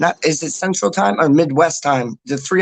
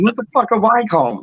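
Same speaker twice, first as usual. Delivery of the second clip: retold disbelief; 7 semitones higher than usual. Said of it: "I'm like".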